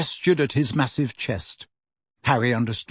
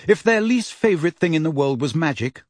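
ordinary delivery, nothing marked

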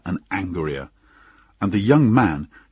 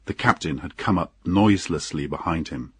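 ordinary delivery

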